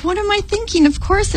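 high-pitched self-mocking realization; duh realization. Dreamer seeing the obvious answer, duh realization. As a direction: scold yourself lightly, pitched well up. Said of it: "it's like a duh moment".

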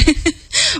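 a quick high giggle. Real, not acted. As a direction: let a giggle slip. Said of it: "I'm just giggly tonight".

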